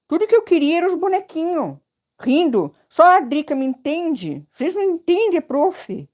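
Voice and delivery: high, fast pouting student voice; mock whiny student. Teacher voicing a student's playful chat complaint, high and whiny. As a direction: play a sulky pupil teasing the teacher. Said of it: "Brincando, né, gente?".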